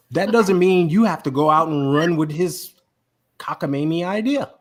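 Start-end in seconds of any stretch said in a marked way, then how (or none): none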